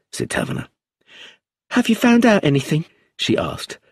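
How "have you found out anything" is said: high, anxious question from a frightened widow; nervous widow. Acted. Widow anxiously asking the inspector for news, high and nervous. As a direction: ask it with nerves tight in the throat. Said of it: "her hands twisting nervously".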